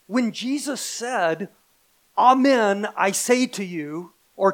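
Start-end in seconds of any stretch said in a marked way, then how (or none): 2.2-4.1 loud, emphatic call for attention; commanding attention. Acted. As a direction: proclaim it forcefully to seize the room. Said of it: "it has the rhetorical effect".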